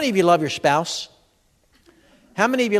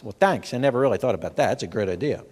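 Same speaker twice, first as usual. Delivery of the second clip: sarcastic thanks; 7 semitones lower than usual. Retold or imitated. Sarcastic reply to advice.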